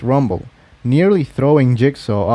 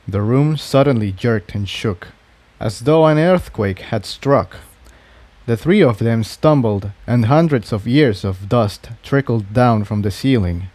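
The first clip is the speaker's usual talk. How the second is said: ordinary delivery, nothing marked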